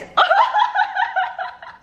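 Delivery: high squealing laugh through food; food-spitting laugh burst. Real, not acted. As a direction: laugh so hard food flies out. I just spit in the face.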